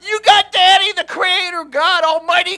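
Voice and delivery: high roaring sermon cry; roaring rallying speech. Real, not acted. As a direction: shout it to rally the crowd. Preacher roars encouragement to listeners.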